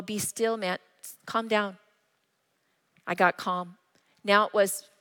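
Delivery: usual speaking voice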